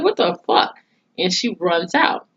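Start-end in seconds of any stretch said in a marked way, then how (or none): 0.0-0.8 high-pitched mock shock; shocked disbelief. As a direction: imitate a stunned daughter, pitch jumping up. Retells daughter's shocked reaction to maid.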